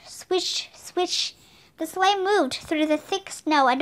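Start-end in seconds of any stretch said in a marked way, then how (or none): 0.1-1.3 breathy, softer whispered swish. A narrator's reading. Narrator voicing the sleigh's glide, whispered swish. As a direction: whisper the sleigh gliding over the snow.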